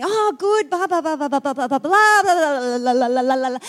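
mock chatter running on without a gap; nonstop babble. Friend at the shops dumping a monologue, babbling on and on. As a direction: rattle off blahs as an imitation of a talkative friend. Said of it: "they go on and on and on".

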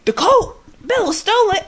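high, loud exaggerated alarm; imitating an overdramatic Sam; mock shocked Sam. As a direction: ham up the big obvious discovery. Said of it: "he's just so fucking obvious".

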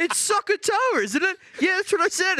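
high mocking whine. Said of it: "old hairy foot over here chimes in".

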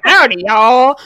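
loud mock Southern greeting; hammed-up Southern twang; Southern accent. Giving example of obvious accent, loud mock Southern. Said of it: "unless you're like".

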